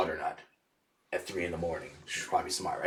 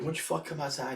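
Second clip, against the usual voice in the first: retold challenge; 7 semitones higher, 3 dB quieter than usual. Acting out his own challenge.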